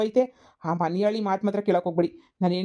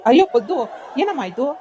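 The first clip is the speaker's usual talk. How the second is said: loud startled "ಅಯ್ಯೋ Paddu"; alarmed cry; 3 semitones higher, 5 dB louder than usual. Acted. Brother-in-law startled by Paddu's tears, loud alarmed cry.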